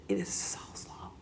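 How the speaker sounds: soft whispered emphasis. Real, not acted. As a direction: drop to a whisper to make it land. Stressing how slow progress is.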